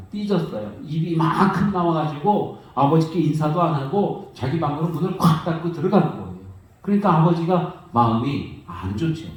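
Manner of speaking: ordinary talk, nothing marked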